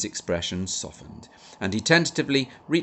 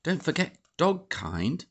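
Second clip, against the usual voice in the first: put-on high, bright little-girl pipe; girl pipes up to correct the Major; piped up; 10 semitones higher than usual. A narrator's reading. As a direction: chirp it out from the back. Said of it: "Ruth piped up".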